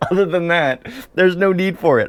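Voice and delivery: chuckling through the words; laughing. Real, not acted. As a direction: laugh it off as you say it. Laughing about rarely using lotion.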